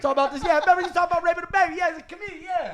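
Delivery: high, loud, fast mocking shout; loud inmate yelling. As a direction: holler it at the comic.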